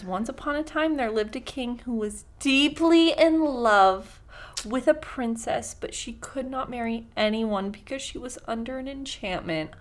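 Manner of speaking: story reading. Acted. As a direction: read it like a bedtime story. Reads fairy tale opening.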